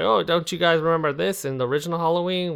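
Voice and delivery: lightly put-on eager fan voice; a hint of a nagging fan; imitated listener. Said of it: "That's not how you sound".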